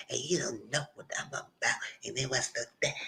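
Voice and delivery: hushed menacing threat. Retold or imitated. Retells a threatening curse.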